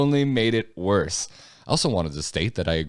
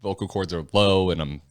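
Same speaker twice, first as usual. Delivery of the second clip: ordinary talk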